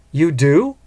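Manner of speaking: excited. A narrator's reading. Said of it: "Iris asked excitedly".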